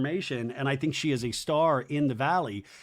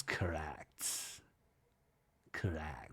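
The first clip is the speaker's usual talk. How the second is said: much lower, much softer, deadpan imitation; deadpan mock Michelle; 11 semitones lower, 15 dB quieter, much slower than usual. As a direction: drop the voice deep and flat for her one-word verdict. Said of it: "Michelle goes".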